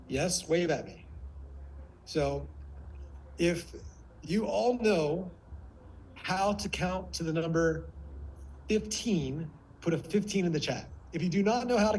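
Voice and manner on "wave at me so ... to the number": usual speaking voice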